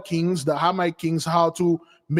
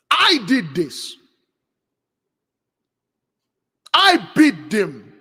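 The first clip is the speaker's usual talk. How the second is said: slow, weighty declaration; boastful; 4 dB louder, slower than usual. Retold or imitated. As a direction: claim the credit slowly and emphatically. Proud victor claims all credit.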